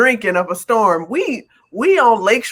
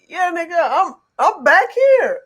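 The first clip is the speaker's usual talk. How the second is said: incredulous retort; 7 semitones higher than usual. Retold or imitated. Acting out reply to police.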